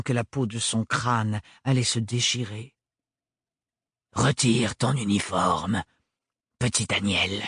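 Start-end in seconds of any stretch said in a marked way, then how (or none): 4.1-7.4 cold lowered command. Acted. Sans-peur orders her to strip.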